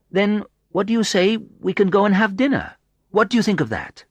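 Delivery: high, coaxing woman's voice; friendly Sandra. A narrator's reading. Sandra suggests rest then dinner to the visitor, coaxing.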